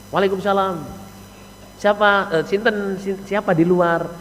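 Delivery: soft, courteous "who's outside"; acted well-mannered wife at the door; polite wife. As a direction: answer the greeting gently and pleasantly. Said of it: "Bahasanya sopan, enak, Saudara".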